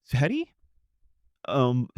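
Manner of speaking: ordinary talk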